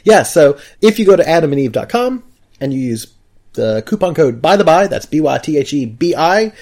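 upbeat ad read. Acted. Ad read with coupon code.

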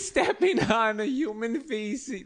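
put-on high, slow, laughing mangled New York accent; the accent falls apart into a squeaky drawl; failing New York accent. Riffing on stepping in poop, a failing New York accent. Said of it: "This is no longer an accent of any kind".

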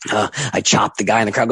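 normal talking voice

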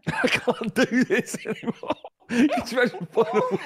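laughing despair. Real, not acted. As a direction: wail it helplessly while laughing.

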